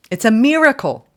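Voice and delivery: high, loud cry of wonder; excited exclaim. Real, not acted. Tarot reader thrilled for her viewers, high and loud. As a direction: burst out with the miracle.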